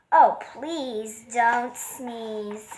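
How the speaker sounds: very slow, drawn-out plea; fish's slow begging refrain; pleading. Acted. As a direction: stretch each word, begging. Fish beg the elephant.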